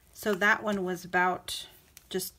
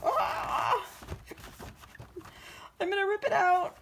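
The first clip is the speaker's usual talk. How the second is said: high squealing cry before tearing the page; anxious squeal; 12 semitones higher than usual. Real, not acted. Crafter squealing over tearing her book page. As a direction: squeal with nervous dread. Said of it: "It's, like, so hard".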